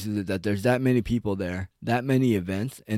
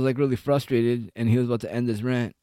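ordinary talk

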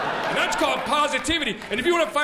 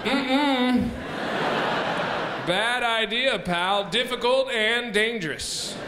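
news promo; slower than usual. Retold or imitated. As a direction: tease a news story, mock-serious. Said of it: "They should be like".

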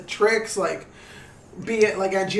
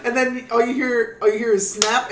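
ordinary talk

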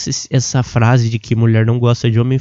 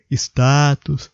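yawning. Real, not acted. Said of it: "Ai, bocejei de novo".

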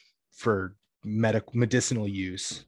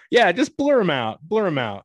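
louder and higher, urgent: blur 'em out; real flustered outburst; 9 semitones higher, 9 dB louder than usual. Real, not acted. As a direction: blurt it out in a panic to hide the names. Flustered, asking to blur store names.

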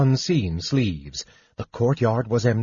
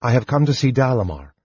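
ordinary delivery, nothing marked

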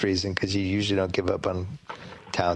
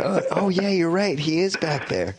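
much higher, mock-dopey voice; sarcastic mock realisation; 8 semitones higher than usual. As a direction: play dumb with a high voice. Sarcastic voicing of the character.

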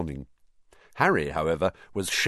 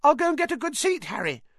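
put-on fast, very high boyish call; piping voice; 3 dB louder, faster than usual. A narrator's reading. Colin calls after Harry heading to changing rooms, piping. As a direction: pipe it out after him. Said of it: "called after him in a piping voice".

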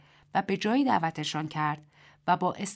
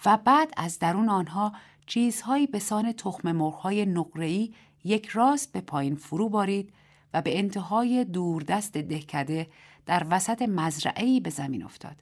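ordinary delivery, nothing marked

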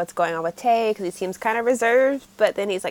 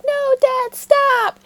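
high pleading no dad stop; pleading sister; 5 dB louder, much slower than usual. Retold or imitated. Sister begging dad to stop, high and pleading. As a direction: beg him to stop. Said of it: "his sister's like".

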